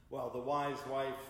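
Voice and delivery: ordinary delivery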